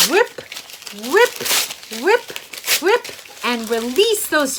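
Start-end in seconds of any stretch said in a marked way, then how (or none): 0.1-3.1 rising, louder rhythmic rips. Shown as a demonstration. Teacher to kids tearing paper, rising loud chant. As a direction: chant each rip higher and harder. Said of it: "pull out some paper".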